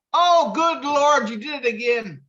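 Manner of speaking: high, slow put-on priest exclaiming; hammed-up dismayed priest, pitched well up. Priest caught out, high slow mock dismay.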